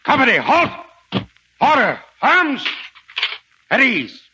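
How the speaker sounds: shouted drill commands. Acted. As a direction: bark it across the parade ground. Sergeant drilling troops.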